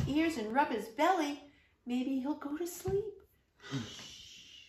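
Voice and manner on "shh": whisper. Acted. Letting tiger sleep.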